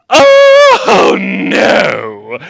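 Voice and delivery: very high, very loud scream of horror; horrified shriek. Acted. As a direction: shriek at the sight of the bear. Eldest daughter sees bear.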